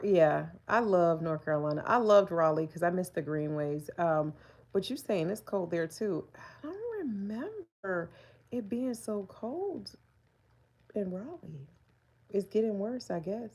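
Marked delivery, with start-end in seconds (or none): 6.4-11.7 soft slow wondering; soft puzzled musing. Real, not acted. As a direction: drift off, puzzled and quiet. Musing about Raleigh.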